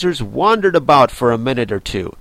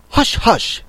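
high little rabbit shushing; urgent shush, not really hushed; a touch of nervous haste; lightly hurried; 10 semitones higher than usual. A narrator's reading. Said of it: "in a low, hurried tone".

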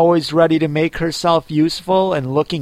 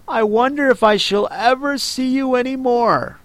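higher, a touch sad; a lonely girl missing her cat; mild sadness; 6 semitones higher than usual. Acted. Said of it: "in a sad tone".

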